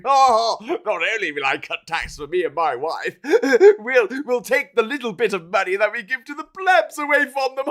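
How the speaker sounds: laughing pantomime villain voice; mustache twirling villain. Retold or imitated. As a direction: gloat like a pantomime baddie, laughing. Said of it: "pantomime villain twirling the mustache going".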